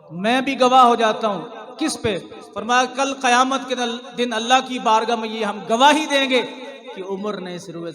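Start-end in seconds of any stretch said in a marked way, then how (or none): 0.1-6.4 loud declaration in a quoted voice; retold bold proclamation. Preacher voices Ali pledging testimony before God, loud proclamation. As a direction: proclaim the testimony forcefully, louder than usual talk.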